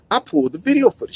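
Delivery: ordinary talk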